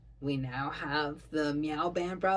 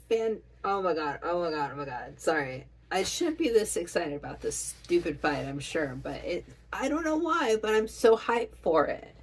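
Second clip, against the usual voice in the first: ordinary talk, nothing marked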